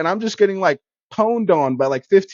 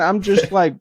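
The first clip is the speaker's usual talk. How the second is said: ordinary talk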